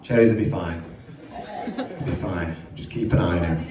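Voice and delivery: low, soft reassurance; calm the caller down gently; reassuring. Retold or imitated. Vet reassuring the panicked owner, low and soft.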